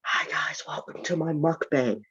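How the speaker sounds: whispered, hushed fake ASMR intro; mock ASMR whisper. Teasing friend with a mock ASMR whisper. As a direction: breathe it like an ASMR host.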